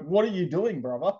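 high-pitched mock yell of alarm; imitating people screaming at him; retold scream. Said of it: "people were screaming at me like".

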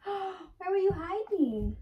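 gasp, then high, drawn-out question; playful mock surprise. Mom playing hiding with her child, mock surprise. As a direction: play amazed for a little kid.

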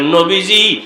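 ordinary talk

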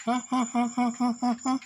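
staccato mock-shivering huhs; imitated panting huh-huh. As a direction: mimic a trembling dog in pulses. Imitates the shaking dog.

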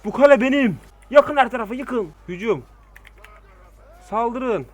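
high, loud war cry; triumphant shout. Real, not acted. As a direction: roar it like a conqueror. Triumphant orders to his army.

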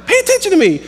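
put-on high, bossy little-girl demand. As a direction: squeal it like a toddler wanting attention. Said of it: "she's just like".

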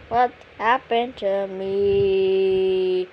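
slow-motion confused; slow drawn-out question. Acted. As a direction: stretch every word out, slow. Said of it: "repeated, second drawn out".

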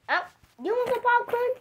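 squeaky high character voice; high toy voice. Pretend. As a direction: pipe it up like a toy.

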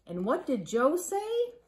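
ordinary delivery

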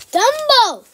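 high, loud title call; shouting out the next movie; excited shout. Real, not acted. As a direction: yell the title with delight.

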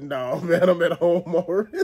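amused brush-off, breaking into laughter; laughing refusal. Retold or imitated. Refusing friend's make-up offer, laughing brush-off. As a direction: turn him down while chuckling.

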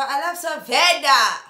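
loud, slow gushing; excited. Real, not acted. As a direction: proclaim your love. Gushing about the drink.

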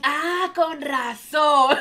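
slow, drawn-out laughing relief; laughing realization. Retold or imitated. She realizes why she was sad, laughing slowly. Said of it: "vi la sangre y dije".

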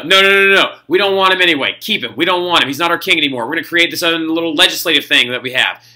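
hammed-up crowd waving their king away; mock French crowd. Teacher voicing the French people disowning Napoleon, mock loud protest.

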